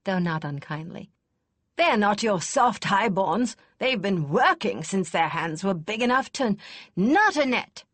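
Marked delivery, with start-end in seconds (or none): 1.8-7.9 put-on louder, gruff old lady scoffing kindly; snorting, not unkind. A narrator's reading. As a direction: snort at the lad but keep it kind. Kester scolding the young herald.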